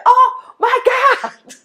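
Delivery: high, slow, laughing shock; disbelieving laugh; laughing oh my God. Retold or imitated. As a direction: gasp it out through a laugh. Shocked at nurse's revelation.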